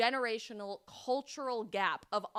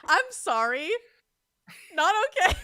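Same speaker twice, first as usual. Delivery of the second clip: laughing, high and loud fake outrage; playful mock indignation through laughter; laughing mock offense; 9 semitones higher, 14 dB louder than usual. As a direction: pretend to be hurt while cracking up.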